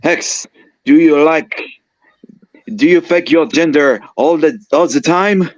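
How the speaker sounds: put-on high, loud French accent. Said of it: "I woke up to a familiar French accent".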